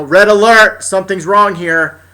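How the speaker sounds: high, loud mock alarm announcement; mock red alert. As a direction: call it like a ship's alarm. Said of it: "siren to go off".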